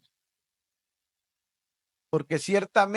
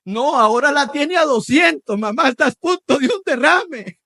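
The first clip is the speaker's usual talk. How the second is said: loud, higher jokey outcry; mock exasperated shout; 8 semitones higher, 8 dB louder than usual. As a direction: ham up the blood pressure joke. Mock comment on the rising tension. (grito burlón exagerado)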